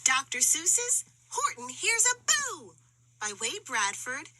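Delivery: plain reading voice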